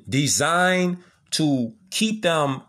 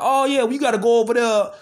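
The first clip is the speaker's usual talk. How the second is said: mocking repeated line; 6 semitones higher than usual. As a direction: parody the line they keep feeding you. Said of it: "getting fed bullshit about".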